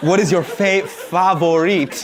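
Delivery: "favorite" stretched slowly into "fah-vo-reet"; halting, over-fancy "favorite" as "fah-vo-reet"; fancy pronunciation. Acted. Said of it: "when people get fancy".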